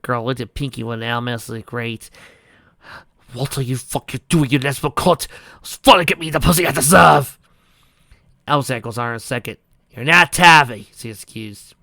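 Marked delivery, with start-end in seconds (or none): 3.3-7.4 rising snarl. Acted. Possessed girl snarls at Pinky. 9.9-10.9 loud slow accusation. Acted. Rainbow confronts possessed friend.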